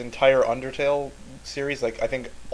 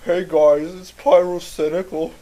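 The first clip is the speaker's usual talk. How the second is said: higher, louder mock-tearful greeting that drags at the end; Pyrocynical impression, about to cry; 5 semitones higher, 7 dB louder, slower than usual. Mocking a YouTuber's intro to a friend, whiny near-crying voice. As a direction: imitate a YouTuber on the verge of tears. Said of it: "he always sounds like he's about to cry".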